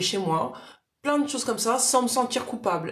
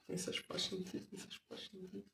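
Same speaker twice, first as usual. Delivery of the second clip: unvoiced whisper to herself; whispered aside; 20 dB quieter than usual. Real, not acted. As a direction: mutter the words without voice. Whispered aside while reading comments. (chuchoté en aparté)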